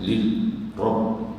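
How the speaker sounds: "Lil Rabb" in separate pieces, doubles held; shaddah stressed. Shown as a demonstration. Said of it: "Itu ada syaddah".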